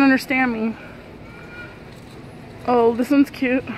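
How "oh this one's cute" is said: ordinary talk, nothing marked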